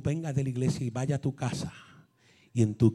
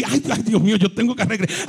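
higher, louder eager cry; excited; 6 semitones higher, 5 dB louder, faster than usual. Pretend. A churchgoer gushing about going back, higher and louder. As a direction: gasp with excitement.

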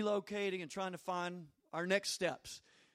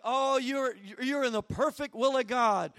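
higher, mock-thrilled delivery; imitating an excited pastor; 4 semitones higher, 6 dB louder than usual. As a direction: gush like the delighted mentor. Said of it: "He just gets all excited".

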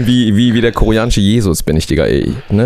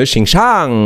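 Xing SHANG, second syllable long and leaned on; stress on second syllable; 3 semitones higher than usual. Retold or imitated. Host demonstrates the mistaken emphasis, second syllable stressed. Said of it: "Betonung auf der zweiten Silbe".

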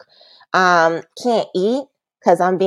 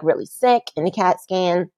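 ordinary delivery

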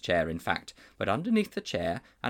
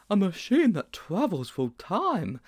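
higher, slow and awed; wide-eyed wonder; 9 semitones higher, 4 dB louder, slower than usual. A narrator's reading. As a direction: breathe it out in amazement. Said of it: "wide-eyed".

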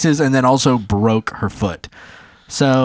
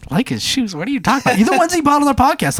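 confused disbelief; 12 semitones higher, faster than usual. Retold or imitated. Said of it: "I'm like".

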